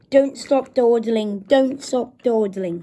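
slow, loud grumble; grumbling. A narrator's reading. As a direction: grumble it slowly and emphatically. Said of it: "he grumbled".

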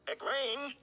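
high, ringing call; calling after her. Acted. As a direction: reach after her as she runs. Rand calls after Egwene.

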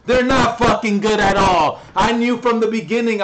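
loud, high, frustrated outburst; angry rant. Real, not acted. Gamer ranting to his viewers about bad teammates, loud and furious. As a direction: blast it at the useless team.

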